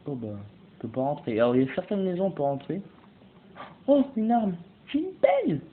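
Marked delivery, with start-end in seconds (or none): 3.9-5.6 high, loud, rising delight; excited discovery. Real, not acted. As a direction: shriek the find in excitement. Finds a weapon. (découverte enthousiaste, criée très aigu)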